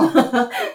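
high real burst of laughter; quick amused laugh. Real, not acted. Laughs about mom's taste.

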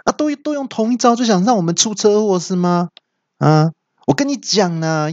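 high, fast, indignant villain yelling; a hammed-up villain squawking at the monk; monster's outburst. A narrator's reading. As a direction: bluster like a cocky martial-arts thug. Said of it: "那三怪听到之后就大叫说".

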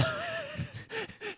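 sudden squealing laugh; high burst of laughter. Real, not acted. Laughing at own song quote. (尖声大笑)

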